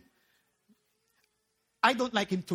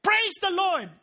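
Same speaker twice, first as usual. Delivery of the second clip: raised mock yell, higher pitched; yelled praise; 6 semitones higher, 4 dB louder, slower than usual. Old lady to the sky from her porch, mock yell. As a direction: call it out like a joyful old believer. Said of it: "and yell".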